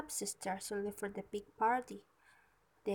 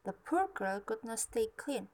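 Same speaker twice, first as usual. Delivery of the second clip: ordinary delivery, nothing marked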